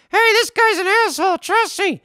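high squeaky hand puppet voice; ventriloquist dummy voice. Acted. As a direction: pitch way up for the dummy.

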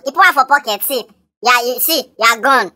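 ordinary delivery

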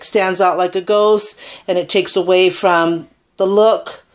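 ordinary delivery

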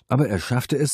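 plain reading voice

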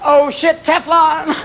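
high cry of a cat in trouble; panicked cat. Acted. Cat hitting the wall finds no grip, panicked. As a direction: yelp out a sudden realization. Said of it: "hit the wall and went".

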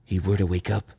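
slightly husky voice; a little husky. A narrator's reading. John fearing the pirate wakes, a little huskily. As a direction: voice the worry with a touch of huskiness. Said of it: "a little huskily".

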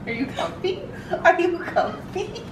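high, giggly coo. Real, not acted. Owner asking her snuggling dog, giggly high coo. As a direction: coo it to a cuddly pup.

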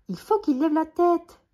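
high, loud shout. A narrator's reading. Billy shouts instructions to Jean-Claude, shouting. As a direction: yell it from up in the tree. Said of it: "crie Billy".

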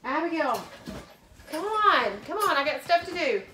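loud high call, getting impatient; impatient shout. Real, not acted. Mom impatiently calling her daughter.

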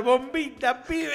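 laughing mock shout at the screen; mock yell. Marcelito yelling in the cinema, laughing mock yell. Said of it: "Empezó a gritar".

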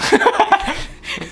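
loud, high burst of laughter. Real, not acted. Co-host drops the muddled explanation, loud laugh. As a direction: crack up loudly.

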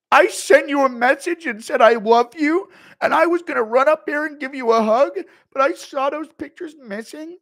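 high, loud hurt rant; Schmitty crashing out. Retold or imitated. Schmitty upset about missing feet pics, high loud rant. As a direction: crash out like a jilted fan. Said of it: "impression of Schmitty crashing out".

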